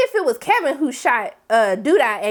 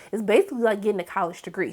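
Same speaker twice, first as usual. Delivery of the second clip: normal talking voice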